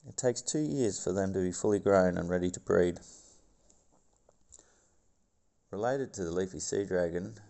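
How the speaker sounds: documentary narrator voice; nature documentary. Acted. Reads documentary script.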